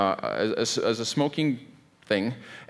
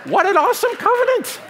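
voice cracking high; 6 dB louder than usual. Real, not acted. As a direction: voice shoots way up, far higher and louder. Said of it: "Why is my voice going up".

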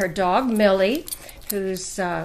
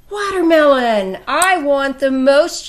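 higher, louder greedy cat yell; story-book yell; 5 semitones higher, 6 dB louder than usual. A narrator's reading. Cat demanding watermelon from Dog, yelled for young listeners. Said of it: "yelled Cat".

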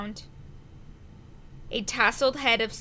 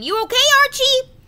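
much higher, fast, loud call; 14 semitones higher, 9 dB louder, much faster than usual. A narrator's reading. First mate calls through the door, loud and quick.

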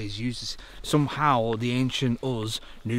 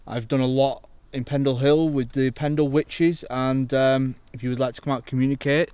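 loud, formal address to the unseen; calling out to spirits; 3 semitones higher, 8 dB louder than usual. Acted. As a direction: announce yourself clearly to any ghosts present. Calls out to the spirits.

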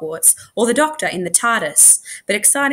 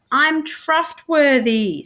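smug boast; 7 semitones higher, 4 dB louder, much slower than usual. A narrator's reading. Tash boasts slowly.

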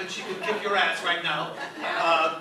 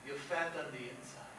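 deadpan line; 7 semitones lower, 11 dB quieter than usual. Shown as a demonstration. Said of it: "and you say".

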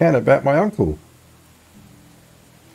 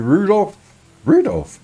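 normal talking voice

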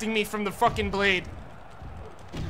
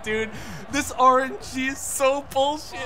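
usual speaking voice

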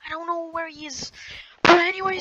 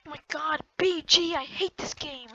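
ordinary talk, nothing marked

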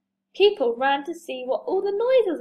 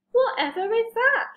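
high, surprised question; high-pitched storybook puzzlement; puzzled wondering; 9 semitones higher, 3 dB louder than usual. A narrator's reading. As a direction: wonder aloud at the noise. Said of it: "she wondered".

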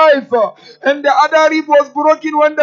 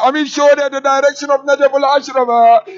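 ordinary talk, nothing marked

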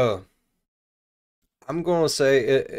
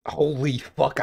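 usual speaking voice

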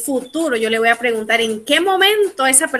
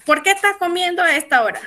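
fast, higher-pitched questioning; quick parental question; 4 semitones higher, faster than usual. Acted. Parent asks fulano why he eats now, quick and high. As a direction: fire the question at your kid. Same question repeated at speed.

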